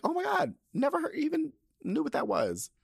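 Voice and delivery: high, squealing mock astonishment; shocked gasp at a new word. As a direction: act floored by an unknown word. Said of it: "I'd be like".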